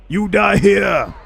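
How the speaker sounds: hammed-up challenge to Torelius; mock battle threat. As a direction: growl it like a warlord in a duel. Threatening enemy general in game.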